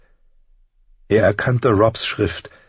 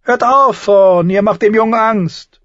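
much higher, louder dwarf voice cutting in; Tyrion rebuking; 6 dB louder than usual. A narrator's reading. Tyrion scolds Thorne for scaring the boy, high and firm. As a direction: step in sharply for the boy.

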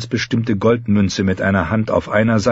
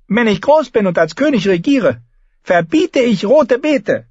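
put-on defiant boy king; 4 dB louder than usual. A narrator's reading. Boy king turns back to threaten a ban, high and defiant. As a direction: announce your grand decree as a small boy. Voices the boy's parting threat.

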